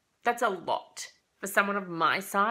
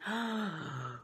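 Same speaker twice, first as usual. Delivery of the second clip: sharp startled intake of breath; shocked gasp as the dad speaks French; 4 dB quieter than usual. Retold or imitated.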